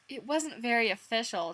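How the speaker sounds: plain reading voice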